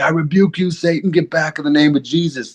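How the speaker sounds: high-pitched, dramatic casting-out of Satan; mock exorcist rebuke. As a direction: cast out the devil, pitched high.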